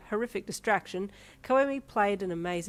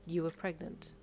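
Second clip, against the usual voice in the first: ordinary delivery, nothing marked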